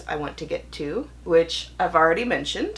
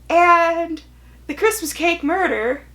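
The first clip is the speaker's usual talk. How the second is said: higher and louder, dreading the verdict; exasperated build-up; 9 semitones higher, 7 dB louder than usual. Real, not acted. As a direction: wind up for the letdown. Building up to a disappointing book.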